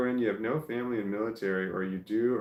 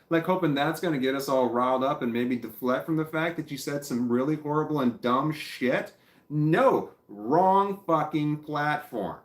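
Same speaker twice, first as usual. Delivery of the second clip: louder, higher furious ranting, slowing hard on the last words; angry yell; 5 semitones higher, 5 dB louder than usual. Real, not acted. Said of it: "Now I'm getting pissed off".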